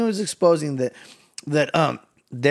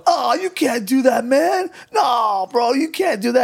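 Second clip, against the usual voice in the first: high loud shouted protest; protesting demon; 11 semitones higher, 4 dB louder, slower than usual. Retold or imitated. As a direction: yell to stop the tag team. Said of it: "and he goes".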